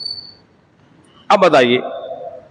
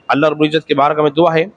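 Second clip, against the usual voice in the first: closing prayer; 8 semitones lower than usual. Real, not acted. Closing prayer after sermon. (धीमी, नरम आवाज़ में दुआ)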